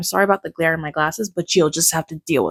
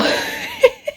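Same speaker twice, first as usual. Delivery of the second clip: a sudden squeaky high laugh, half crying; teary high-pitched giggle; high tearful laugh. Real, not acted. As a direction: laugh out the overwhelm in a high burst. Overwhelmed after finishing book.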